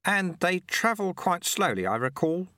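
put-on high, chirpy old man's voice; amused little old man teasing; old sweeper. A narrator's reading. Old sweeper gently mocks the shamed challenger, high and chirpy.

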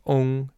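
"ong" with a rounded O sound in place of eng; "ong" the way it is said in Taiwan; southern ong ending. Shown as a demonstration. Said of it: "more of an O sound".

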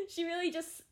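high, giggly start through laughter; helpless little laugh in the voice. Real, not acted. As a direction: squeeze the words out while laughing. Giggling over the fence incident.

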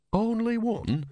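very high-pitched disbelief; high, startled repeat; incredulous echo. Acted. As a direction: throw it back, pitched right up.

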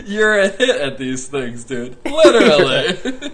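high, loud, bursting with laughter; laughing hard. Real, not acted. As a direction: squeal the last word, drawn out. Cracking up, joining the bit.

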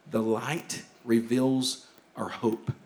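hushed, slow statement. Shown as a demonstration. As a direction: lay it down quietly first. Key line repeated, first hushed.

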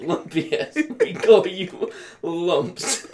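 slow, high mimicry with laughter; imitating the lame insult, laughing; mock imitation. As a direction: drag out the taunt while laughing. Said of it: "then be like".

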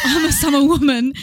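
laughing through the words. Real, not acted. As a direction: giggle as you correct yourself. Laughing correction.

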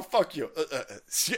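ordinary talk, nothing marked

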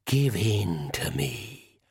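slow, breathy tempting whisper; eerie whisper. Acted.

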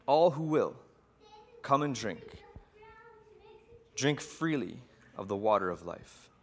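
measured, reverent storytelling with long pauses; sermon story narration. A narrator's reading. Preacher retelling John's vision to listeners, reverent.

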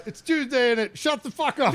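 high, hammed-up British outburst; mock British accent. Imitating a British meme clip, mock British accent. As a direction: squawk it as a cartoon Brit. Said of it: "British thing".

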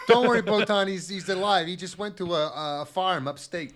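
loud, high fake comforting; mock reassurance. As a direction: comfort her with an obvious lie, loudly. Mock reassuring a grieving girl.

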